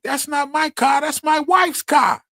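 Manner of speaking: very high, loud, drawn-out squawking outburst; Gilbert Godfrey impersonation. Retold or imitated. Man redoing his movie-line impersonation for co-host, high shouted squawk. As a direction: shout it far up high as an exaggerated comic impression. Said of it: "I was doing my Gilbert Godfrey impersonation".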